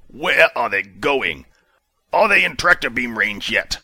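clipped commanding officer tone; hint of a stern commander demanding answers; commander Justice, lightly voiced. A narrator's reading. Commander questions crew.

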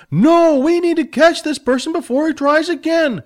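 loud, high insistent reply; heated argument. Acted. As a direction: argue back hard. Billy argues back loudly.

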